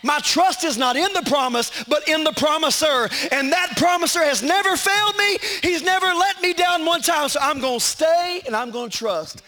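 climbing from firm to a full preaching shout; building sermon cry. Acted. Pastor rousing the congregation, building to a shout.

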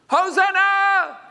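high, loud, drawn-out hosanna; shouted hosanna. Retold or imitated. Preacher echoing the children's cry, long and loud. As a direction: ring it out like a crowd cheering.

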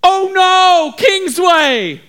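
high, loud alarm cry; screaming lookout. Acted. Lookout spots enemies from the wall, screaming. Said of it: "he began to scream".

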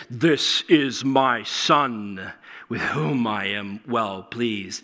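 slow, low voice of God; slow, low divine proclamation; voice from heaven. Acted. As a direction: boom it out slowly as God. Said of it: "this voice comes from heaven that says".

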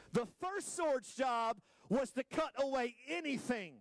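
high, slow sermon shout; forceful preaching. Real, not acted. Preacher telling the church the sword's job, forceful and drawn out. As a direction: hammer it out slowly to the crowd.

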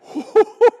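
high burst of laughter; rueful laugh. Retold or imitated. Voicing Abraham to the congregation, a high rueful laugh. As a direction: laugh out at the hopelessness.

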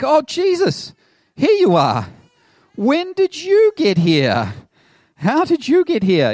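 high, loud, eager crowd voice; mock excited crowd. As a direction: gush like a crowd that found him. Crowd finding Jesus, mock excited.

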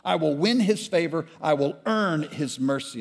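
high, loud, indignant ranting; outraged shout. Acted. Brother in the river shouting his sins at the firstborn. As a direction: hurl the guilt out furiously.

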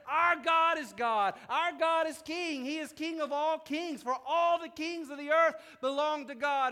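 loud, rousing psalm proclamation; rally the congregation to shout praise; preacher at full volume; full-force preaching. Acted.

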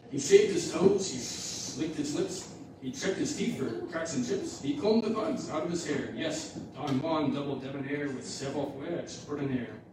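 lively, sing-song storytelling to the congregation; rhyming story read aloud. Acted. Pastor reading a kids' rhyme to the church, lively storytelling. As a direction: read the rhyme with bounce and drama.

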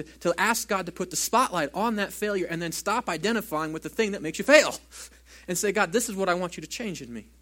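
full-force preaching to the church. Acted. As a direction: push the challenge home to the congregation.

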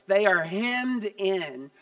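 normal talking voice

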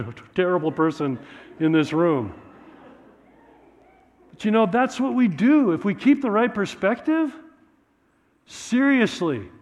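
ordinary talk, nothing marked

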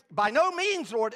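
indignant refusal; emphatic refusal. Acted. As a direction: refuse it flatly.

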